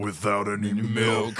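slow, put-on boast; cartoon tough guy. Answering what's so tough about that, slow boast. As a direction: draw it out like a hard man.

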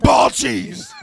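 loud laughing yell; laughing shout. Real, not acted. Teasing shout about gross fact.

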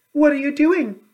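put-on high, loud young princess voice; a bright high princess calling a greeting. A narrator's reading. Princess asks old woman.

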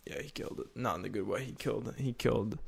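barely voiced and soft; quiet. Real, not acted. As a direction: drop to a near whisper. Soft repeated remark.

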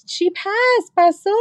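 very high, overjoyed imitation of mom; tearful mother. Crying mom announces the news to sister, high and overwrought. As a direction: squeal it as a weepy proud mom. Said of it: "she starts crying".

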